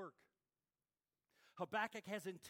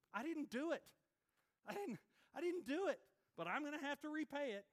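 mock protest, higher; 7 semitones higher, 3 dB louder than usual. Voicing the wronged psalmist.

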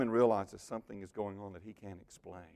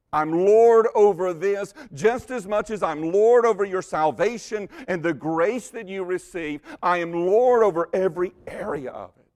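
loud, higher, declaring authority; commanding; 7 semitones higher, 10 dB louder than usual. Acted. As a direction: proclaim it like a ruler. Voicing Jesus with authority.

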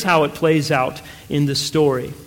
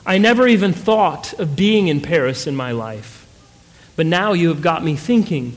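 usual speaking voice

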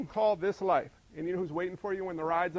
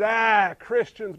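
scornful sneer; 8 dB louder, much slower than usual. Retold or imitated. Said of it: "looks at us with derision and says".

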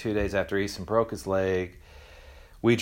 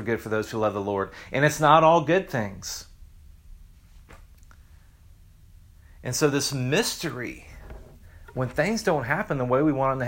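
higher, louder preacher voice driving the point home; fervent preaching; 4 semitones higher, 3 dB louder than usual. Acted. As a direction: preach it out with rising conviction. Preaches with rising force.

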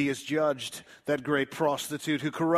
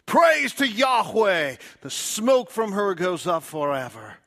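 crying out praise; 6 semitones higher, 10 dB louder than usual. Acted. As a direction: shout the praise up to heaven. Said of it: "they cry out".

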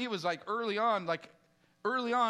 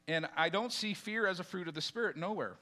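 ordinary talk, nothing marked

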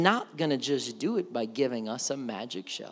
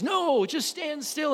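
Moses; 12 semitones higher, 3 dB louder than usual. Retold or imitated. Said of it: "And Moses says".

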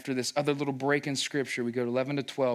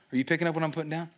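ordinary delivery

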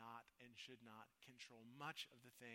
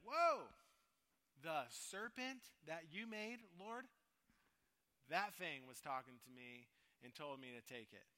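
deflect it onto the snake, wide-eyed; defensive blame-shifting; 2 semitones higher, 8 dB louder than usual. Retold or imitated. Eve answering God, startled, blaming the serpent.

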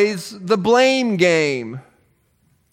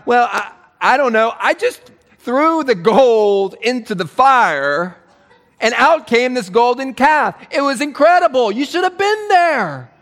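higher, wide-eyed innocent excuse; mock-amazed Aaron, pitch climbing; sheepish Aaron; 5 semitones higher than usual. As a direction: play dumb and marvel at it. Aaron voiced making excuses.

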